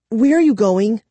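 sharp, high challenge. A narrator's reading. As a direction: snap the question out. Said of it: "Kara said sharply".

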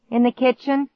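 high-pitched call from another room; calling back. A narrator's reading. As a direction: call out an answer. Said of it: "he called".

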